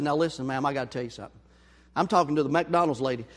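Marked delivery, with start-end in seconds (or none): 0.0-1.4 confiding to cashier. Retold or imitated. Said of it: "I said".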